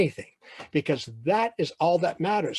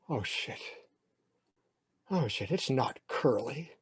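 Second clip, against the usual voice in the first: sinking realization; 2 semitones lower, 7 dB quieter than usual. Acted. Boy realizing the nickname is an insult, hushed dismay.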